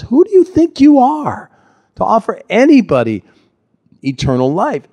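indignant challenge. Retold or imitated. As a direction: scoff at his claim, pitch leaping up.

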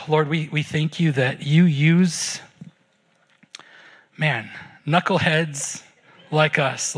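low, halting prayer with long pauses; heartfelt prayer. Real, not acted. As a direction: pray aloud sincerely, pausing between petitions. Leads the closing prayer.

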